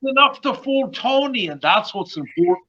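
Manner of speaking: badly faked Irish lilt; bad Irish accent. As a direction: keep up the fake Irish brogue, pitched high. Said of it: "worst Irish accent I've ever heard".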